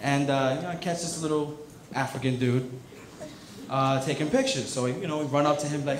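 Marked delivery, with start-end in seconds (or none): none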